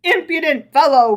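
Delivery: loud angry roar. A narrator's reading. Rabbi to Herschel, angry roar. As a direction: bellow the insult. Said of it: "roared the rabbi".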